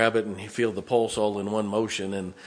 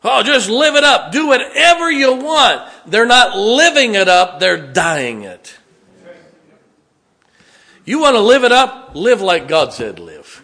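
very loud, high, stretched-out cries; full-force preaching; 11 semitones higher, 14 dB louder, slower than usual. Real, not acted. Preacher urging church to live like God said, full-force preaching. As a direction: hit the peak of the sermon, slow and forceful.

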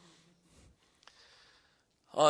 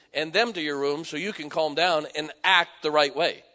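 raised, forceful sermon voice; emphatic preaching; 5 semitones higher, 9 dB louder than usual. Real, not acted. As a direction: preach it with conviction, louder.

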